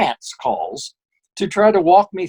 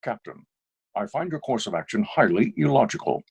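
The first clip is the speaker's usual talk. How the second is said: low, soft, measured officer; Spock; 7 semitones lower, 6 dB quieter than usual. Shown as a demonstration. Voice actor demonstrating for the host, low measured impression. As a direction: lower the voice and state it with restraint. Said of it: "give me a little Spock".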